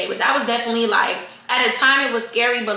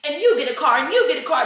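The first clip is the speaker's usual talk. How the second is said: Oprah giveaway; 6 semitones higher than usual. Retold or imitated. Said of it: "I feel like Oprah".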